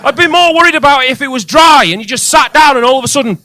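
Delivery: high, loud, exasperated rant; loud disbelieving tirade; incredulous rant. Real, not acted. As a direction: blow up at the absurd note. Ranting about the damp discharge note.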